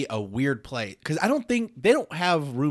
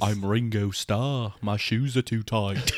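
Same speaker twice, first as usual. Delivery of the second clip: slow, badly faked Liverpool accent; mock British deadpan; 3 semitones lower than usual. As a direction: plod through it as a dopey British drummer. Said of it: "He's the one that sounds like this".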